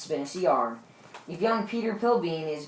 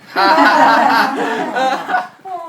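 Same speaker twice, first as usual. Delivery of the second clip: hearty laugh; 6 semitones higher, 9 dB louder than usual. Acted. Host laughs at the cook.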